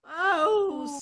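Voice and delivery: loud, bold donkey voice; a confident ass calling out to a friend; hearty donkey. Acted. Ass replies to the cat.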